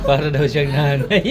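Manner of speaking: words spilling out through laughter; laughing. Real, not acted. Grandmother laughing as she repeats the child's joke.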